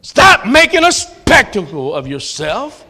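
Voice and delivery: God scolding a tantrum, high and loud at first; sharp loud reprimand that then settles; a fed-up father telling off a whining child; stern rebuke. Retold or imitated. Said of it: "He's gonna say".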